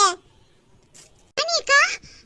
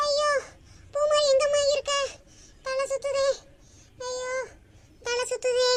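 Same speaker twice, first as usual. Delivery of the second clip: faint; 8 dB quieter than usual. Acted. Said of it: "எனக்கு தலை சுத்துற மாதிரி இருக்கே".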